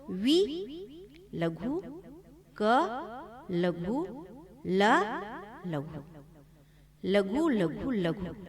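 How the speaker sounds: विकल split into वि, क, ल, each called लघु; syllable by syllable. Shown as a demonstration. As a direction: slowly spell out each syllable. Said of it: "वर्ण के आधार पर".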